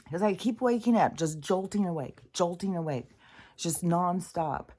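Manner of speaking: ordinary talk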